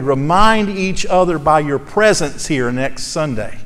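rally the congregation to keep each other accountable; preaching. Acted. Pastor exhorting his church toward Sunday school, full-voiced preaching.

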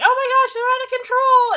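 high, loud panicked cry; mock panic. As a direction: squeal it like a dad losing control. Dad panicking in retold scene.

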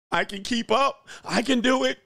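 put-on a kid sister insisting she can manage; mock little sister. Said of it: "She out there".

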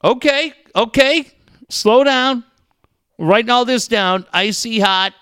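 high, loud, hurried host eagerness; mock eager. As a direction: pretend to scribble every word down. Mock-eager host taking notes.

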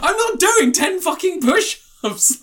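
high, slow, emphatic mock protest; indignant refusal. Actor refusing the drunk woman's push-up dare, mock indignant. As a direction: dig your heels in, word by word.

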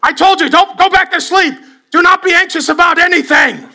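hammed-up angry dad barking orders; mock yelling dad. Dad yelling verse at child.

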